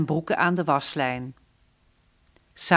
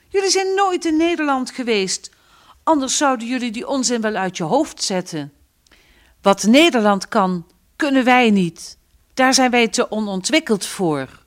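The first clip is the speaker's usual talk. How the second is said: higher, louder heated rebuke; angry scolding; 5 semitones higher, 5 dB louder than usual. Acted. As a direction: let the anger rise as you lecture them. Father angrily lectures family.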